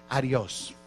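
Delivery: "adios?" said the Spanish way, ah-dee-OHS; Spanish pronunciation. Shown as a demonstration. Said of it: "How do you say".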